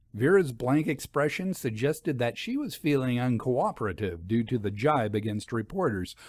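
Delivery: ordinary delivery, nothing marked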